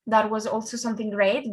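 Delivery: ordinary talk, nothing marked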